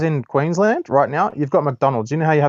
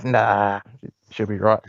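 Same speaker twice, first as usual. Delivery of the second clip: lower, relaxed Aussie shrug; no worries, mate; aussie tradesman; 6 semitones lower, slower than usual. Retold or imitated. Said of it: "you look like you're probably gonna fall off".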